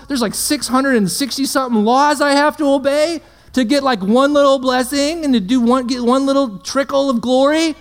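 mock outrage from a saint who could only dream of it; incredulous Old Testament saint. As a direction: play an ancient believer stunned at how easy we have it. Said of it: "They'd look at us like".

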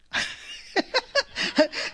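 high, breaking peal of laughter; helpless laughter. Real, not acted. Preacher amused by her listeners, laughing hard. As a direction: crack up for real. Cracks up at congregation's reaction.

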